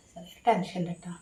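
hushed whisper so as not to wake anyone. Real, not acted. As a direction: whisper it late at night. Late night farewell to viewers. (രാത്രി വൈകി മന്ത്രിക്കുന്നു)

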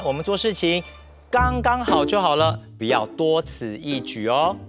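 usual speaking voice

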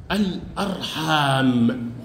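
low, very slow, stretched الأرحام; drawn-out model answer. Shown as a demonstration. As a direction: say الأرحام slowly, dragging out the long aa. Teacher models the plural answer. (الأرحام ببطء مع مد الألف)

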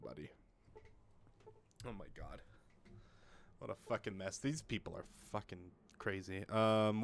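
usual speaking voice

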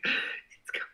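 excited unvoiced whisper; breathless anticipation. Streamer acting out waiting for a delivery, excited whisper.

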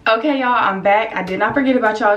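ordinary delivery

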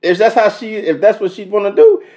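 high, loud, fast pretend warning to a rival; mock rival confrontation. Reactor voicing the other man in [name]'s song, high and loud. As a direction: play the jealous guy telling him to back off.

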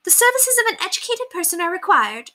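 high, haughty lady's voice quoting a curt note; mock-haughty high lady. A narrator's reading. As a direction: read it as a snooty summons. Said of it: "the curt message".

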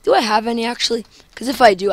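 ordinary talk, nothing marked

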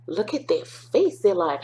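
ordinary delivery, nothing marked

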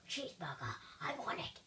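very low, rough growly voice; playful monster craving. Pretend. Player wanting the cheeseburger, low monster voice. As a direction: growl it greedily with little voice.

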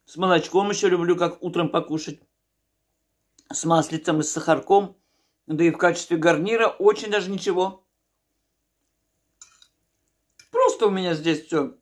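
speaking between bites; talking while chewing. Real, not acted. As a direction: mouth partly full of food. Seen 0: Eats spoonfuls of porridge; cheeks move chewing between spoken phrases.

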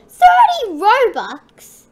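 high, loud, drawn-out shock; scandalised gamer squawk; outraged disbelief. Real, not acted. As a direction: balk slowly at the price. Shocked at respawn price.

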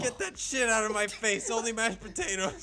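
loud, high mock outrage; mock angry. Astronaut refusing Italian bread, hammed-up angry refusal. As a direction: push the food away in fake disgust.

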